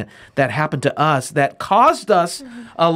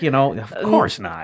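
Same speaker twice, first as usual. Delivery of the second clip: ordinary delivery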